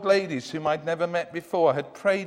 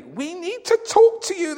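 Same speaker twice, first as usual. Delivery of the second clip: put-on high, louder old woman voice; high frail but determined old lady; old ladies insisting; 13 semitones higher, 7 dB louder than usual. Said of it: "they said".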